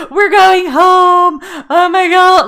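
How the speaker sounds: high, loud, slow tearful cry of relief; relieved crying joy. Retold or imitated. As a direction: cry out that we're finally going home. Kids' relief at being called.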